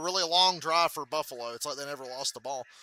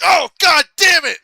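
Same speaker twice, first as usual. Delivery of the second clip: slow, much higher, much louder shout; pained shout; 14 semitones higher, 19 dB louder than usual. Retold or imitated. Tourette's Guy hit by the falling phone, pained shout. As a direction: howl it like you just got hit. Phone hits him in the nuts.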